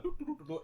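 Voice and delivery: very high imitated busy little noises; cartoonish run of doop sounds; high sing-song doops. Acting out shifting pallets at work, high playful doops.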